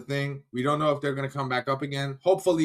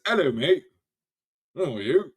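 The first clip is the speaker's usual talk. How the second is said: light mock British accent, a bit higher and louder; 3 semitones higher, 5 dB louder than usual. Streamer reading a viewer's chat, mock British accent. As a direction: read the viewer's greeting like a cheery Brit. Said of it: "reading his chat with a British accent".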